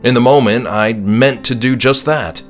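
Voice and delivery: plain reading voice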